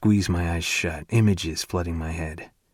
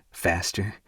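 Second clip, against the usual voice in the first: usual speaking voice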